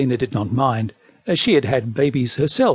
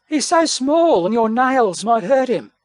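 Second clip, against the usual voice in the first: high, mildly anxious voice; protective, pitched-up mother; worried mother bear; 7 semitones higher than usual. Acted. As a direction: plead high for the baby's safety. Mother bear protecting her baby.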